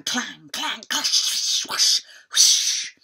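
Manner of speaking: loud voiced clangs and whooshes; battle noises. A narrator's reading. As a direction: make the sword fight heard. Battle sound effects.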